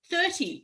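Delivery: Thirty said high and stressed alone. Retold or imitated. Repeating the head count, stressing Thirty high. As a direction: punch Thirty as amazement. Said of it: "I was like".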